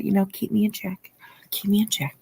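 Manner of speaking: soft unvoiced whisper to the chat; breathy whispered aside. Real, not acted. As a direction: hush it like a private joke.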